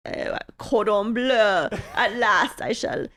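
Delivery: slow, hammed-up British chef accent; slow mock British accent, a villain eyeing the bird; cartoonish British chef. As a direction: savour each word like a pompous British chef. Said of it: "A cartoonish accent".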